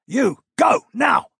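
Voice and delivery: sharp loud high command; shouting. A narrator's reading. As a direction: bark each word as an order. Prince orders Corin mid-battle.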